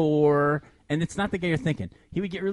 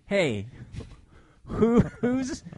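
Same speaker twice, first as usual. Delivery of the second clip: mock prissy; 5 semitones higher than usual. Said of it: "Except he didn't use those words".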